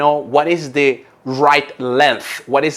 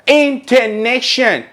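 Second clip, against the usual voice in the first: intonation, high and drawn out; stretched intonation; 9 semitones higher, 4 dB louder, slower than usual. Shown as a demonstration. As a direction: say intonation slowly with a high falling tune. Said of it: "Do you see the difference?".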